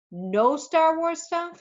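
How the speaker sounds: high, drawn-out disbelief; incredulous. A narrator's reading. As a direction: stretch it slowly upward as an astonished question. Doctor mock-shocked at not knowing film.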